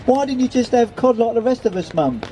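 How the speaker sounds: high-pitched, complaining daughter; mock annoyance, pitched well up; a little annoyed. A narrator's reading. Daughter questions her mum's choice of fish, a little annoyed. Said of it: "seemed a little annoyed at her mother".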